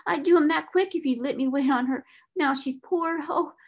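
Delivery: high-pitched, tearful, halting begging; trembling plea. A narrator's reading. Scullery maid begging to wait on Miss Sarah, trembling. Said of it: "she trembled".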